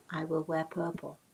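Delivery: ordinary delivery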